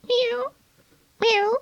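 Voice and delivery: very high, thin cat mew; high plaintive miaow. Acted. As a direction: mew like a cat shut outside. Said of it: "interrupted Simpkin".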